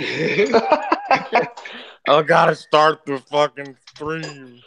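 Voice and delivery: put-on loud, slow, slurring royal drunk; drunk king voice. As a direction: bellow it like a sozzled monarch. Acting out drunk general in bathtub.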